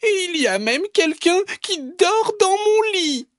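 very high squeaky outcry; tiny baby bear. Acted. Baby bear finding someone in his bed, tiny high cry. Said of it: "crie petit ours de sa toute petite voix".

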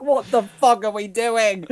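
high, slow, drawn-out disbelief; incredulous retold reaction; bewildered outburst. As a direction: recall your disbelief in a high, slow cry. Retells own bewildered reaction.

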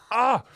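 high, loud pained cry. Retold or imitated. Driver hurting his shoulder, high loud pained cry. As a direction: yelp as the shoulder gives. Pain on steering.

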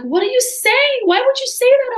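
high-pitched, alarmed disbelief; retold shock, pitch shooting way up; shocked manager. Manager shocked at layoff question.

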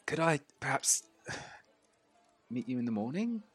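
quiet, sighing polite request; tentative request. Acted. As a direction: ask softly and hesitantly. Half-orc tentatively asks drunk player.

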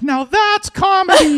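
put-on a drunk fan in the mosh pit gushing; mocking a dim festival-goer; dopey crowd member. Drunk festival crowd cheering him.

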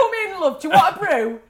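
high, loud, hammed-up hostess; welcoming family host. Powell family greeting the French girl, hammed-up welcome. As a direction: call it out high and loud, fussing over a guest. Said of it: "handed over to the Powells".

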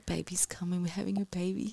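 soft awed whisper; whispered quote. Retold or imitated. Telling Adam the baby is coming, whispering. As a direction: breathe it like a secret. Quoting herself to Adam at crowning.

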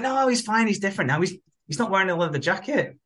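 high-pitched mock protest; acted-out naive insistence; defensive reassurance. Acted quote defending Darcy to Stu.

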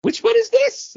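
put-on high child voice asking; a kid asking his dad which one; child's question. Son asking dad about the turtle, high child voice. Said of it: "Grayson was like".

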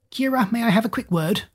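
high, gentle worried teacher; concerned teacher. A narrator's reading. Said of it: "asked the concerned-looking teacher".

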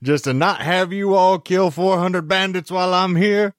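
high twangy mountain-man voice; a hillbilly from the Smoky Mountains boasting. Pretend.

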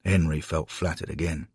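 ordinary delivery, nothing marked

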